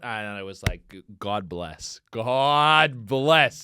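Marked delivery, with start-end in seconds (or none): 1.2-2.0 low, soft, quick blessing; quiet thankfulness; low relieved sigh of thanks. Retold or imitated. Relieved reaction, first low take. 2.1-3.6 louder, drawn-out blessing; big emphatic thanks; emphatic relief. Retold or imitated. Same relief repeated, drawn out.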